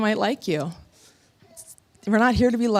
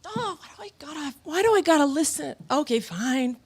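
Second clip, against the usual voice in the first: reluctant self; 7 semitones higher than usual. Retold or imitated. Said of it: "part of you is like".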